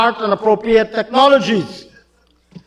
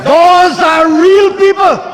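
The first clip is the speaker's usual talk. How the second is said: fiery oratory; 6 semitones higher than usual. Acted. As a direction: rail at the opposition benches.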